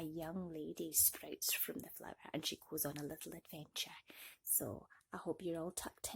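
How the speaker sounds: soft bedtime whisper. Real, not acted. Whispering the bedtime intro to sleepy children.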